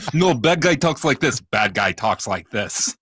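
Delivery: Russian-accented villain impression, starting high and loud; slow into a hammed-up Russian villain accent; mock Russian bad guy. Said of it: "The Russian guy".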